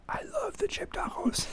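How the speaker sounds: breathy excited whisper. Retold or imitated. Dad imitating his daughter, whispering about tacos. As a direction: hush it like a thrilled little kid sharing a secret.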